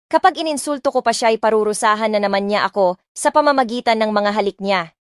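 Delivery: ordinary delivery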